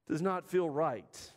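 ordinary talk

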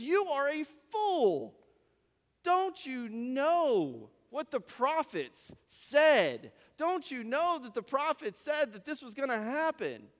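high, drawn-out scolding; emphatic rebuke. Acted. Stranger calls the travelers fools over the prophets, reproachful. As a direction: stretch the words out in reproach.

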